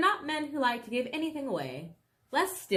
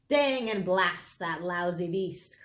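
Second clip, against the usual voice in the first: crying out as Bogus; 3 semitones lower, slower than usual. A narrator's reading. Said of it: "cried Bogus".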